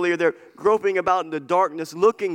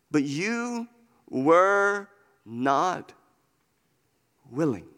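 slow, heavy pauses between words; halting lament; much slower than usual. Retold or imitated. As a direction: let it fall away, word by word. Voicing Jesus' lament, broken up.

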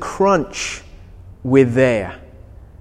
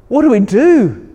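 mock panic; 6 semitones higher, 4 dB louder than usual. Dad panicking in story.

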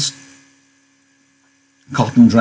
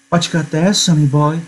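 lightly voiced, higher-pitched American woman; flirty woman character; 6 semitones higher than usual. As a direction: lift the pitch for a teasing question. Voicing Curley's wife in a novel.

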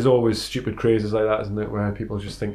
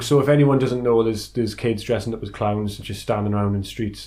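ordinary delivery

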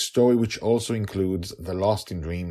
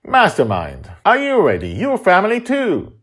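rallying showman; 12 semitones higher, 9 dB louder than usual. Acted. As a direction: stretch it out like a ringmaster. Justin rallying the family.

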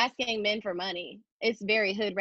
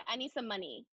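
flat quiet ask; 11 dB quieter than usual. Shown as a demonstration. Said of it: "And I just stare".

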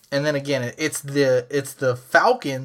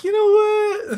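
high quoted inner voice; a sudden change of mind, voiced high; voicing his own thought; 3 dB louder, slower than usual. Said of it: "and I was like".

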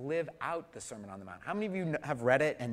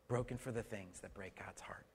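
softer, breathy hush; whispered; 6 semitones lower, 12 dB quieter than usual. Acted. Preacher repeating the point in a whisper. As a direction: drop to a whisper for the heart.

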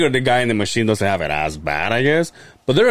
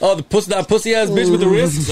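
laughing mock quote; 8 semitones higher, 3 dB louder than usual. Mocking quote while laughing.

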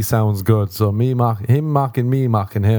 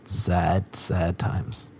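softer, lower mock mourning; quiet mock sadness; 5 semitones lower, 7 dB quieter, much slower than usual. Real, not acted. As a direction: mutter it like a sigh.

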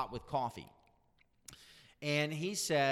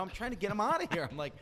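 ordinary talk, nothing marked

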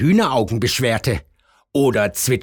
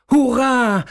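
much higher, louder jubilant cry; cheering; 13 semitones higher, 4 dB louder than usual. A narrator's reading. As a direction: cheer with joy. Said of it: "jubelte der Spatz".

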